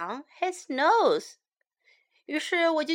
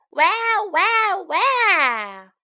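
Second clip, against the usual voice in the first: high, loud mock baby wail; baby crying; 6 semitones higher, 5 dB louder, much slower than usual. Storyteller acts the baby woken by a fly, mock wailing. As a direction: wail like a baby, each cry stretched. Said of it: "宝宝哇哇哭了起来".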